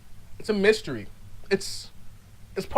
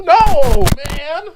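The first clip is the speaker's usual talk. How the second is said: loud, high, emphatic no; outraged outburst, louder and higher; indignant shout; 4 semitones higher, 6 dB louder than usual. Acted. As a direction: shout it in exasperated refusal. Indignant rejection of speed-rap demand.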